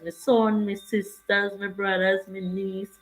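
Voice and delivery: usual speaking voice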